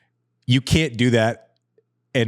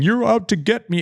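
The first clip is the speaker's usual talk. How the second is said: mock victim whine; 9 semitones higher than usual. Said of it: "professional victimhood".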